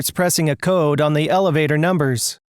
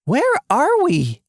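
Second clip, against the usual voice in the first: high, slow question; acted lost and uneasy; bewildered; 9 semitones higher, slower than usual. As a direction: ask it slowly in high-pitched bewilderment. Lost in a dark tunnel.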